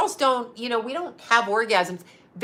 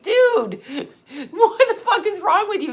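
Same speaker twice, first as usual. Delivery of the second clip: laughing disbelief; 10 semitones higher, 3 dB louder than usual. Retold or imitated. Retelling her reply to guy.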